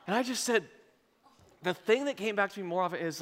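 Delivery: ordinary delivery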